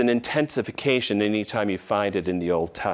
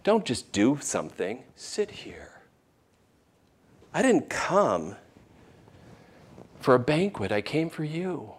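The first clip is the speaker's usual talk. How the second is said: soft, fading to a near whisper, tender and tearful; tender plea; 2 semitones higher, 6 dB quieter than usual. Retold or imitated. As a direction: plead gently, almost whispering. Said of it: "a tear coming from his eyes".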